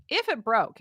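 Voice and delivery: normal talking voice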